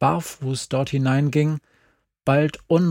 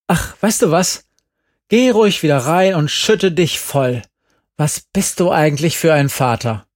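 loud, higher, scornful; contemptuous scolding; 3 semitones higher, 5 dB louder than usual. A narrator's reading. Mother scorns her drunk ex-husband, loud and bitter. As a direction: spit the contempt at him.

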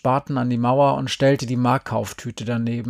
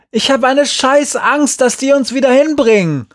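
very high, loud, frightened outburst; full-voice desperate yell; yelling back; 13 semitones higher, 9 dB louder than usual. A narrator's reading. Boy afraid of being taken back, yelling back. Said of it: "brüllte Glenn zurück".

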